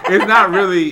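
words bubbling through laughter; laughing. Real, not acted. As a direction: say it while cracking up. Laughing mid-sentence.

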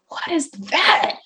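slow, high whisper of confusion; hushed baffled question; whispered bewilderment. Retold or imitated. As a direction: whisper it like you can't believe it. Retold confusion at second diagnosis.

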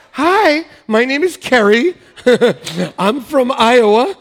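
loud, high mock-tearful agent; upset phone agent. Customer service person introducing herself after being yelled at, hammed-up distress. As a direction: imitate a rattled agent, big and halting. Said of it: "I've had people cry".

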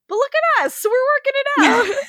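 a laughing, high goofy-charming take; Paul Rudd voice. Retold or imitated. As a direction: sell it like a grinning charmer. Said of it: "Paul Rudd voice".

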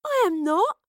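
high, loud mock-indignant protest; indignant cry. A narrator's reading. Girl protesting the witch tease, crying out. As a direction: cry out in protest, pitch leaping. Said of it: "Astrid cried".

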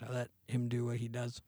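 ordinary talk

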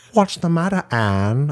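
high teasing jab at her name; mocking taunt. Mocking her partner with a high teasing taunt. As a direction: needle her with a sing-song sneer.

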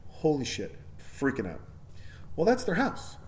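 usual speaking voice

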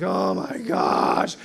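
slow, drawn-out exhausted groan; mock worn-out complaint; acted weariness. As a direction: drag it out as if people drain you. Acting drained by people at noon.

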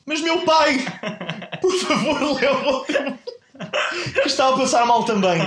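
high, hammed-up plea to God, half laughing; mock pleading prayer. Aunt at the funeral begging God, high mock plea. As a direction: beg the heavens in a high mock wail. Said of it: "volta-se para o céu e diz".

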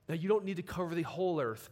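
ordinary delivery

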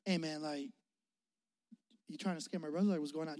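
timid confrontation. Retold or imitated. Brother imagined meekly asking Travis, soft and timid. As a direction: ask it meekly, almost afraid to. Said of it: "I thought he was just gonna be like".

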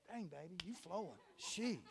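soft low self-praise aside; aside to himself. Real, not acted. Preacher praising his own flow under his breath. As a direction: murmur it, pleased with himself.

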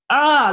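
loud, high roar of abandon; wild passionate "ah". Retold or imitated. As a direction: let out a full-on, raw "ah". Imitating wild, full-on passion.

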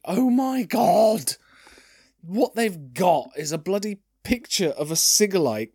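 real disbelief at a silly picture; shocked. Real, not acted.

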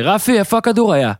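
lightly voiced Rabin asking who's right; voicing Rabin. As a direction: demand the line call from your partner. Rabin appealing to partner in dispute.

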